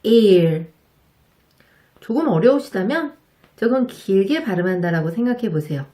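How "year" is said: ear said louder, with a pulled-in y glide before it, slightly longer; year with y glide. Shown as a demonstration. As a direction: start ear from the throat, a touch longer. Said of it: "두 번째 단어".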